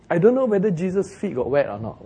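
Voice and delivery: ordinary talk, nothing marked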